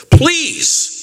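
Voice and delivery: high, loud desperate plea; a dying mother's sudden high please; pleading. Retold or imitated. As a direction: beg him with everything left. Dying widow begging.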